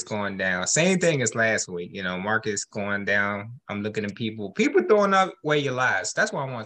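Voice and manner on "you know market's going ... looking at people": normal talking voice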